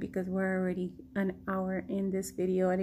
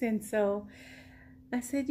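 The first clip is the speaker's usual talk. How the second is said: ordinary talk, nothing marked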